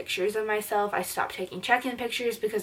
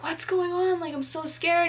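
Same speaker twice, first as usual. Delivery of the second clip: worried mom; 10 semitones higher than usual. Retold or imitated. Mom voiced in retold scene.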